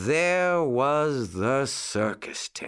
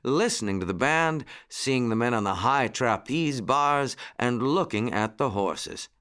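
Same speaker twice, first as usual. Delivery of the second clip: ordinary delivery, nothing marked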